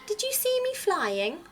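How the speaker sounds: high, proud little chick; little owl voice. Acted.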